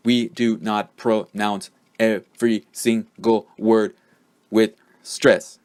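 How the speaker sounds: "We do not pronounce every single word with stress" punched out one syllable at a time. Shown as a demonstration. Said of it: "don't stress every syllable".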